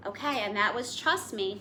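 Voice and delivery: ordinary delivery, nothing marked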